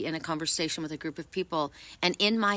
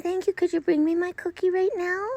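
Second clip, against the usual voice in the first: lightly higher cat's voice; voicing the cat; 12 semitones higher than usual. Voicing the cat asking for a cookie, lightly higher. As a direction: speak for the kitty, a bit higher. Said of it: "He's saying".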